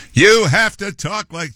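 a shaky go at an oratorical hearing voice; Curtis's hearing voice. Shown as a demonstration. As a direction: try on a pompous testifying voice. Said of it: "I can't do it very well".

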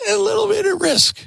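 squeaky falsetto mimicry of her; mocking high voice. Host mocking Michelle's line in a high falsetto squeak.

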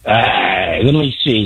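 normal talking voice

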